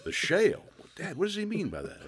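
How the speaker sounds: high puzzled "shale?" rising; a kid baffled by the word; puzzled boy. Retold or imitated.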